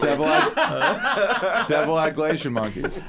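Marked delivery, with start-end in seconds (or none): 0.0-1.7 slow, drawn-out laughing words. Real, not acted. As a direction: stretch it out through laughter.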